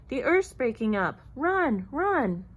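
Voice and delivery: loud storybook cries of alarm; shouted warning. A narrator's reading. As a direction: urge everyone to flee, voice rising. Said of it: "running and shouting".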